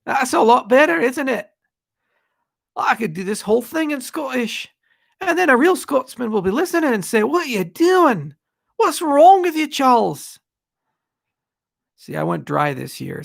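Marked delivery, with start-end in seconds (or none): 0.1-10.4 high-pitched, hammed-up Scottish accent. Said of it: "I do Scottish".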